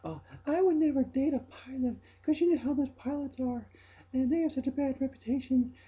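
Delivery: high, loud gushing ditzy voice; mimicking coworker. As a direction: gush it like a clueless airhead. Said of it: "She was saying".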